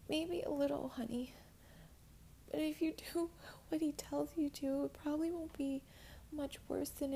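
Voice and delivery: soothing. Acted. Comforting the terrified girl.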